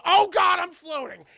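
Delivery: high, loud mock panic; sudden yelp of alarm, acted out; startled outcry. Girl suddenly floating in mid-air, startled cry.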